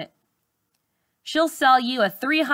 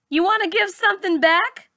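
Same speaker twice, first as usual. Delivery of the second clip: much higher sarcastic question; mocking echo; 10 semitones higher than usual. A narrator's reading. Charlize mocking the rich teacher, high sarcastic echo. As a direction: throw her words back at her.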